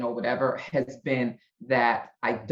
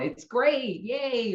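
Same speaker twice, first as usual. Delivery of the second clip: higher-pitched mock-approving cheer; pretend enthusiasm; 9 semitones higher, much slower than usual. Writer imagining flattering the producers, with pretend enthusiasm. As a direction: put on a pleased yes-man's gush. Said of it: "could have sat there and gone".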